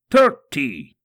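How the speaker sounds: loud cry of a number; sudden loud cry under the whip; pained count. Acted. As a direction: shout the count as the lash strikes. Counting lashes during the scourging.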